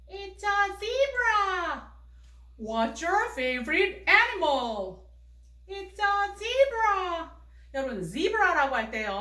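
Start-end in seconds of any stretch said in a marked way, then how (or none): 0.1-1.9 put-on much higher, very slow child voice answering; 높은 아이 목소리. 미미 역할로 높은 목소리 대답. 2.6-5.0 put-on slow, deliberate model sentence for kids; 천천히 또박또박. 5.7-7.3 put-on much higher, very slow child voice answering; 높은 아이 목소리.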